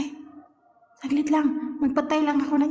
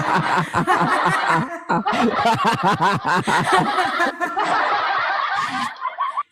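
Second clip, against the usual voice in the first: laughing fit; 5 semitones lower than usual. Real, not acted.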